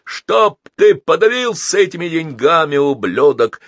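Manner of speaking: loud, high angry bark; bellowed angry retort; barked curse. A narrator's reading. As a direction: hurl the insult loudly. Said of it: "гаркнул дельфин".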